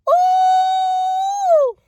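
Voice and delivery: very high loud ooh of outrage; long high held ooh; outraged squeal. Real, not acted. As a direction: shriek of disbelief. Outburst at cancellation.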